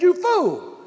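amused mock scolding, laughing. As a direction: laugh at him as you call him a fool. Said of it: "she's laughing".

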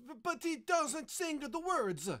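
high, loud, stammered objection; sputtering. Acted. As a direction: sputter in indignant protest. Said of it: "sputtered Baldini".

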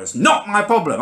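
hammed-up stubborn driver repeating it, pitched high with a laugh; mock him as he digs in; aggressive driver. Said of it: "He keeps going aggressively now".

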